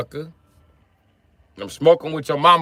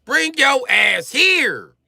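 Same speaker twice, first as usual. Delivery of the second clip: slow taunting shout; 7 semitones higher, much slower than usual. Acted. Taunting outsiders to come visit.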